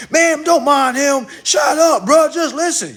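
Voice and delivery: high, loud shout imitating hecklers; hammed-up high-pitched yelling; mock shouting. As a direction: shout it down like a fed-up crowd. Mimics viewers shouting someone down.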